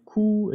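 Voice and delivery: "Q" as a pure "koo", pitched high; Spanish U sound. Shown as a demonstration. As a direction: say "Q" with a Spanish oo vowel. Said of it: "se pronuncia como la U español".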